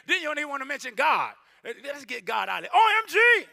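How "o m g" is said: shouted OMG. Pretend. Mocking casual blasphemy.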